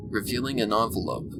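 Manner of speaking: plain reading voice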